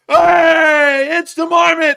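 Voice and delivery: loud, high geezer holler; Brexit geezer marmot. Pretend. Marmot announcing himself to co-host, shouting geezer. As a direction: shout a huge cartoon entrance. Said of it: "the accent of the marmot is that of a Brexit geezer".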